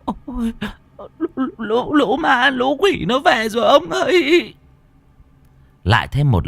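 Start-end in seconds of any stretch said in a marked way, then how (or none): 0.0-4.5 much higher, panicked stammer; stammering in terror. Acted. Terrified wife stammering to her husband about ghosts. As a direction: stutter it out in terror. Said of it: "miệng lắp bắp".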